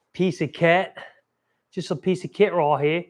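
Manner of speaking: badly faked British accent; horrible British accent. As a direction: do a bad mock-British voice. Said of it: "that was a horrible British accent".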